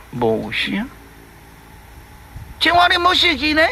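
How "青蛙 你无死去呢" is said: high, worried question to the frog; anxious question. Acted. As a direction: ask if your friend is really dead. Calling the dead frog.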